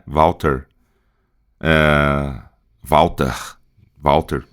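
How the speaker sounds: Walter with a breathy, aspirated r at the end; aspirated regional Walter. Shown as a demonstration. Teacher contrasting regional accents for students: aspirated Walter. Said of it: "Algumas regiões com mais aspirado".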